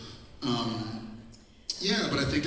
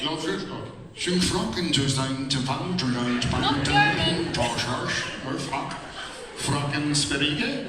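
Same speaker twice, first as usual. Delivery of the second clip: hammed-up mock Swedish; accento svedese; 4 dB louder than usual. Shown as a demonstration. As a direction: rattle off made-up words in a Swedish accent. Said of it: "give us your best Swedish accent".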